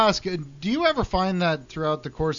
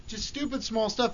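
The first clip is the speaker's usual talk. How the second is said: ordinary talk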